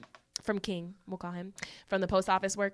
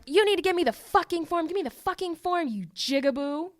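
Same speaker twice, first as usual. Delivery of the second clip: high-pitched imitated angry demand; mock irate yell; 7 semitones higher than usual. Podcaster acts out customer turning back on the clerk, mock irate. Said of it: "starts yelling at him".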